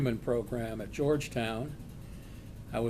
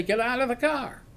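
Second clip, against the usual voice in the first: firm order; 8 semitones higher than usual. Retold or imitated. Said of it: "I say".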